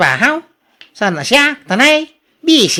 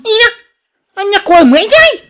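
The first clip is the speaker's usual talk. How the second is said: indignant surprise; 10 semitones higher, 5 dB louder than usual. Acted. Husband reacts to wife's question.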